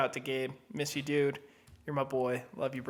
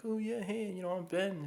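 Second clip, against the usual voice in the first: lightly drawled laid-back dude voice, softer; a hint of a surfer dude; surfer dude impression; 8 dB quieter than usual. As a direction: mimic a chill Cali surfer. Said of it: "Is that how I talk?".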